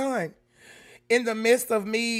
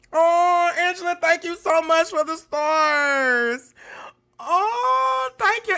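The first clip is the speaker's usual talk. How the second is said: tearful thanks; 7 semitones higher, 4 dB louder, slower than usual. Real, not acted. Tearing up over viewer gifts.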